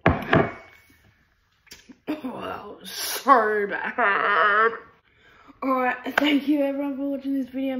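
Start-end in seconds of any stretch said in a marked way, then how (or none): 0.0-4.7 drawn-out half-crying groan; pained whimper after sour. Real, not acted. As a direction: whine it out, wincing at sourness. After drinking lemon juice.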